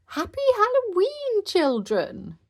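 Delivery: high, loud festive greeting; joyful cry. A narrator's reading. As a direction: call out to children with a smile. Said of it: "cried Madame Gazelle, smiling".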